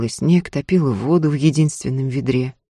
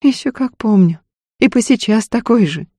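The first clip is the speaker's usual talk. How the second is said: higher, vexed comeback; heated; 7 semitones higher than usual. A narrator's reading. Wife answers Ефим about his old reputation, heated. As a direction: fire back in annoyance. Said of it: "в сердцах отозвалась Устинья".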